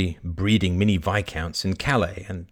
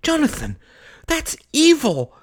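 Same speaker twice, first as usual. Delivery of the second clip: scandalised outrage; 5 dB louder than usual. A narrator's reading. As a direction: scold a cruel prank, aghast. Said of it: "Kay's jaw dropped".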